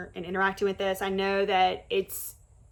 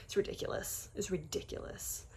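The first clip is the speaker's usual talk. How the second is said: whispered aside; 12 dB quieter than usual. Real, not acted.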